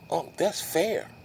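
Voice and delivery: put-on drawn-out childlike approval; lightly voiced daughter won over; mock child agreeing.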